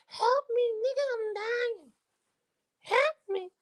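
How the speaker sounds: high, hammed-up wail of someone stranded; mock cry for help. Mocking a man stuck on the mountain, high fake wailing. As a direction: squeal it like a helpless man on a mountaintop.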